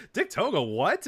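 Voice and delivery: high, baffled question; surprised. Retold or imitated. Fans tweeting confused about the surprise return. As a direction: toss the name up in disbelief.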